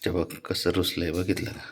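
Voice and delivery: hushed remark about the pouting pet; quiet aside. Real, not acted. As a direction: drop your voice to share it.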